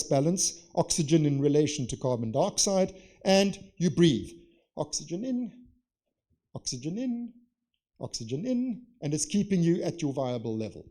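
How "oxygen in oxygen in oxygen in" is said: softer, higher, spaced-out little phrases; quiet rhythmic demo of breathing in. Shown as a demonstration. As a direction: breathe the words out gently like a steady reflex. Demonstrating the breathing reflex.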